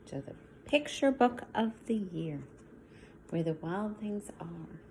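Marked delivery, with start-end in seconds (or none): 3.3-4.8 whispered title, hushed. Shown as a demonstration. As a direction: whisper it like a secret. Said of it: "whispered title after plain one".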